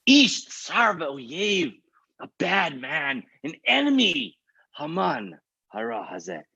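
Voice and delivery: high, slow, drawn-out denunciation; impassioned accusation. Acted. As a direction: draw out each word as you accuse. Esther accuses Haman to king.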